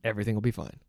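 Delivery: low, hushed reassurance; calming self-talk. Acted. Dad telling himself all is well, soft and calm. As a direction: soothe yourself, soft and low. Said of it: "Just calm down".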